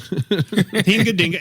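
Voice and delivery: high, slow mock-German babble through a laugh; mock German gibberish. Host joking about being bad at German, in mock German. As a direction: fake a silly German accent. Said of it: "Wrong, wrong accent".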